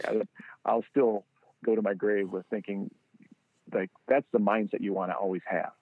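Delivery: ordinary talk, nothing marked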